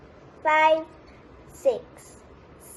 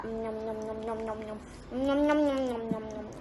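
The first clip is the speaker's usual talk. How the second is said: soft, low nom-nom chomping; munch away quietly like a pretend alligator; playful munching noises; 3 semitones lower, 7 dB quieter, faster than usual. Alligator puppet eats the children.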